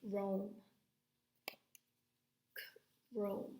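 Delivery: chrome with a hard k at the start, slow and clear; modelling chrome. Shown as a demonstration. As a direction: say chrome carefully for the class. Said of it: "I'm saying it".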